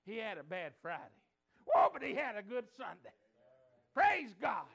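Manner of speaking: loud, high preacher's shout; triumphant preaching cry. Real, not acted. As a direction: shout the good news. Preaching climax.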